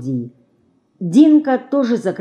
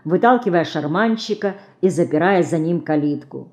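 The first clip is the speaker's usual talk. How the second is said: usual speaking voice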